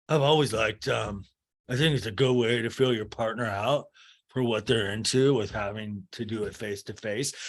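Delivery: lightly mimicked slow, serious man's line read; slow, serious Craig. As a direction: drag it out slow and earnest, a touch creepy. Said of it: "he got really slow. He got really slow with it and serious".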